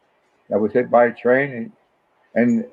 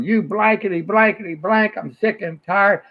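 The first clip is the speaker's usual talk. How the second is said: higher, slowed mock rant; mock angry neighbour; 6 semitones higher than usual. Father retells angry neighbour yelling, higher mock rant. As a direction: play the cranky homeowner sputtering at golfers. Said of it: "the guy standing out there going".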